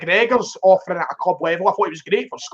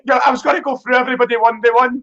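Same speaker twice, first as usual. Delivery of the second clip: raised, laughing protest; 5 semitones higher than usual. Real, not acted.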